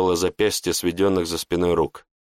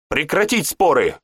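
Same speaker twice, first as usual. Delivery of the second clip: commanding raised voice; stern command; 6 semitones higher than usual. A narrator's reading. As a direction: stop the argument firmly. Priest commands.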